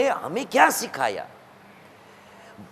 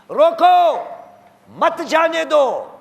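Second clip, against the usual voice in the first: raised "روکو" alone, pause, then slow "مت جانے دو"; pause after روکو; 5 semitones higher, much slower than usual. Shown as a demonstration. Preacher translates the verse as command, pausing after روکو. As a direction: call out روکو, then bind مت to جانے دو, slowly. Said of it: "یہ خدا کی بات ہے".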